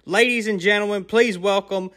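booming ring announcer, drawn out. Acted. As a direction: introduce him like a title fight. Boxing-style champion introduction.

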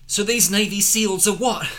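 high, loud incredulous question; loud exclaiming. Acted. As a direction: shout it in disbelief. Character exclaims in story.

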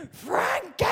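high shouted name; mum's shout. Retold or imitated. Mum yelling at the neighbour's yapping dog, a high shout. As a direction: yell the dog's name like a fed-up mum. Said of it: "my mum was like".

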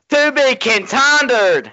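slow, high, drawn-out dramatic line; Family Guy quote. Retold or imitated. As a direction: stretch every word out slowly. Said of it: "special Skywalker kid's gonna come out at the end of the movie and say".

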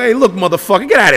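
retold yell. Big brother bursting in on the sister's boyfriend, yelling. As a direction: tell him off at full volume. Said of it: "I go".

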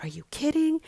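high-pitched retold disbelief; incredulous. Friend confronting boyfriend over her credit card, incredulous. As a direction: imitate a friend's incredulous question.

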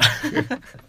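squeaky burst of laughter; high giggle. Real, not acted. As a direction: let out a high little laugh. Amused laugh mid-sentence.

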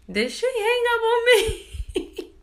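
very high, drawn-out incredulous question breaking into laughter; shocked disbelief. Retold or imitated. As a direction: squeal it slowly in disbelief. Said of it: "I was shocked".

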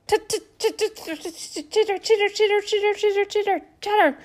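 high, rapid squirrel chittering. Acted. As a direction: chatter like a squirrel, teeth and tongue. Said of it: "they do this little chitter chatter sound".

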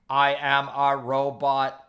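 slow stiff robot voice; flat mechanical robot delivery. Pretend. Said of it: "but you're doing a robot voice".

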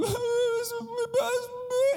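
high-pitched mock bawling wail; child's crying. Pastor mimics a crying kid to the congregation, mock bawl. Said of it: "imagine your kid comes home and she's crying".

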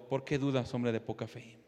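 low, soft question to a neighbour; quiet aside. Acted. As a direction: drop right down and pass the reproach along. Same question passed to neighbour.

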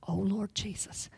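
hushed, frightened whisper; scared whisper. Acted. Preacher imagining the frightened woman, whispered. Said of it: "I can imagine being her and being scared".

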